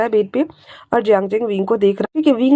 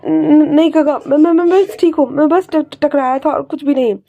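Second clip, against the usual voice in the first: higher, louder, stammering denial; frightened stammer; 5 semitones higher, 4 dB louder than usual. Acted. Wing, scared, stammers to his uncle that he only bumped. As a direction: stutter out a nervous lie.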